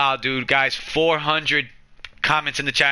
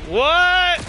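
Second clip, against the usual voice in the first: high, startled "What?"; stunned what; 4 dB louder than usual. Real, not acted. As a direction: pitch jumps up in disbelief. Second raid shock.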